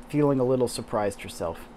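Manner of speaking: usual speaking voice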